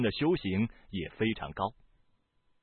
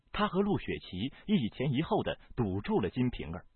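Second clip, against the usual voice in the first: plain reading voice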